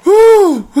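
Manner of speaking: high loud whoop of laughter. Real, not acted. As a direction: crack up with a woo.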